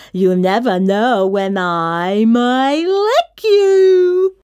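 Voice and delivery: slow, loud, rising spooky wail; hammed-up menace swelling to the end; trying to sound terrifying. A narrator's reading. Slobbery monster trying to scare the kid, mock-terrifying. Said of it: "trying to sound terrifying".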